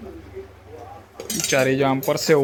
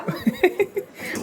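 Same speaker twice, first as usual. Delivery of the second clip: burst of laughter; 4 dB quieter than usual. Real, not acted. Laughs at his own joke.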